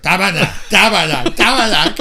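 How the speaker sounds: high, loud chant of the ringtone; copying the message tone over and over; imitated phone alert. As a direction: mimic the phone going off repeatedly. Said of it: "it was like".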